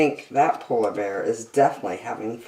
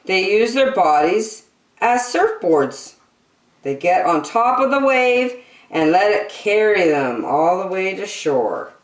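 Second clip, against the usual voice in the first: bright, lilting picture-book read-aloud; reading to children; 7 semitones higher, 5 dB louder than usual. Acted. Reading an animal picture book to young children, bright and lilting. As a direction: read it like a bedtime nature book for kids.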